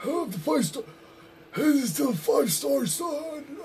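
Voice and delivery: high, hammed-up announcer voice; mocking Joey Ace. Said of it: "That's what Joey Ace does".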